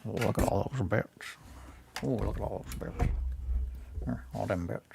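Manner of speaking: lightly voiced bumbling Mister Bean, mildly amused; Mister Bean impression. Streamer shows viewers her Mister Bean voice, lightly mumbled. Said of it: "say it like, uh, Mister Bean".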